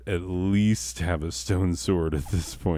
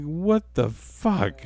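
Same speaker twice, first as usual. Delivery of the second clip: wife baffled; 10 semitones higher, slower than usual. Retold or imitated. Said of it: "It's like".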